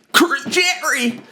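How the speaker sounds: high, strained, drawn-out cry; annoying voice. Pretend. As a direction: blurt it in an obnoxious high voice. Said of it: "Most annoying voices".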